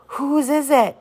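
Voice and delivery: high, anxious little question; frightened child. A narrator's reading. Scout asks her father whose house burns, frightened. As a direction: ask in sudden fear.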